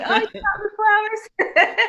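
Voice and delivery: wife teasing her husband, gushing with laughter; laughing mock delight. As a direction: gush over the apples through a laugh. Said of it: "That's our ongoing joke".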